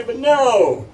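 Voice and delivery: loud, high, drawn-out "no"; emphatic comic objection. Acted. Storyteller refuses to let the story end, loudly emphatic. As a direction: exclaim it big for the twist. Mock outrage at the story's twist.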